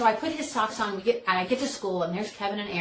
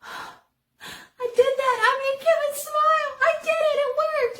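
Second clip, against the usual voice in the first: much higher and louder, slow and giddy; thrilled triumph; 7 dB louder, slower than usual. Retold or imitated. Beth thrilled to herself that she made Kevin smile, giddy and high. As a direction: gush with amazed delight.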